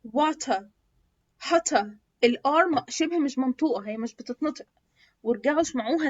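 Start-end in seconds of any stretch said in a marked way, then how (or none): 0.1-0.6 British "water" as "wa-tuh"; British accent. Shown as a demonstration. Teacher showing how British say it, British accent. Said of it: "البريتش بينطقوه ازاي؟". 1.4-1.9 British "hotter" as "ho-tuh"; British accent. Shown as a demonstration. Teacher showing how British say it, British accent. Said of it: "البريتش بينطقوه ازاي؟".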